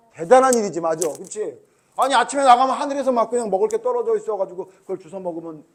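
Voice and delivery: ordinary talk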